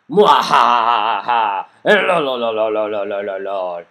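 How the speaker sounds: evil mad scientist cackle. Pretend. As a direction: cackle like a crazed villain. Cackles before reading the letter.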